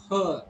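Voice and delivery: "Her" with no R at the end; R not pronounced. Shown as a demonstration. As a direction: finish "Her" on the long vowel. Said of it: "R sound is not pronounced at all".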